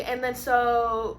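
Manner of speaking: usual speaking voice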